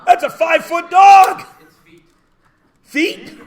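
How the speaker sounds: loud laughing shout of disbelief; mock outrage. Real, not acted. Teacher shouting at a student's answer, loud disbelief. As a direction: yell it like the dog is huge.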